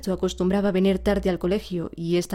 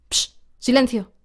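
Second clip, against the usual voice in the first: slow, louder cross shush; grumpy hush; 4 semitones higher, 4 dB louder than usual. A narrator's reading. Teacher silences the noisy class, grumpy shush. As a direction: hush the class in a bad temper. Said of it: "dijo malhumorado el profesor".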